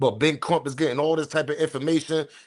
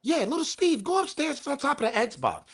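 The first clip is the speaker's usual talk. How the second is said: put-on high, falsetto girl voice; squeaky falsetto impression of a girl; mock girl's voice; 12 semitones higher than usual. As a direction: pitch way up to play the girl. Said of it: "She tell the boys, like".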